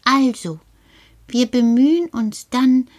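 ordinary talk

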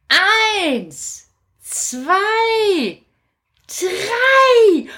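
climbing high count, last number loudest; excited count. Acted. Kasperl counting down the apple trick, rising. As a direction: count up to the big release.

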